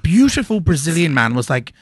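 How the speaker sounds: ordinary delivery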